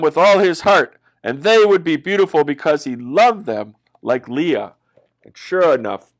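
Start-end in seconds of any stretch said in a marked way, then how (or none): none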